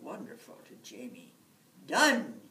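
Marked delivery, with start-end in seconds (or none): 1.9-2.4 loud eager shout. Acted. Jamie accepts the leprechaun's wish, a loud eager shout. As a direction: seal the bargain with a shout. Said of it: "he shouted".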